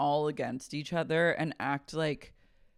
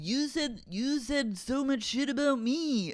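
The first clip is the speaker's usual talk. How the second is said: high, drawn-out complaint; mock whine; 8 semitones higher than usual. Retelling his complaint to his ex, mock whine. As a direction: imitate a whiner, pitched up and stretched.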